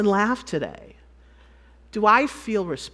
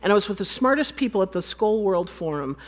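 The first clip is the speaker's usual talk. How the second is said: ordinary talk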